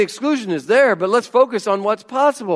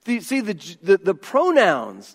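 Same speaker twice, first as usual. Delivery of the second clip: usual speaking voice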